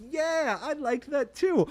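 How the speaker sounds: high, slow, sweet voice of an agreeing viewer; playful imitation of a viewer nodding along. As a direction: lightly mimic a fan happily agreeing. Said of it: "And then you'll be like".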